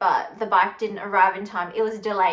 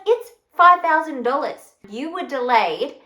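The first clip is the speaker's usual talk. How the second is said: louder, higher protest, then slowing; indignant; 4 semitones higher, 7 dB louder than usual. Retold or imitated. Telling the shipping company off about the fee, indignant.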